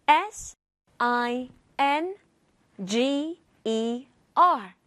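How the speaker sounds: S I N G E R spelled letter by letter, slow on G E R; over enunciating. Shown as a demonstration. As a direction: spell S I N G E R crisply and slowly. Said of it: "let's spell it out again".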